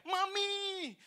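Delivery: very high, drawn-out squealing cry; comic scream. Retold or imitated. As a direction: shriek it as a car crying for mummy. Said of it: "langsung tuh Honda langsung teriak".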